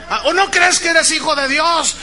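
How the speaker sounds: high, loud challenge; manipulative preacher. Retold or imitated. Pastor challenging his congregation's belief, rousing shout. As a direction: goad listeners like a hype preacher. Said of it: "Meter la manipulación emocional".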